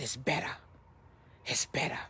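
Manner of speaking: low hush fading into a whisper; weak patient, imitated. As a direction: retell a sick man's faint relief. Sick man's relieved report, retold.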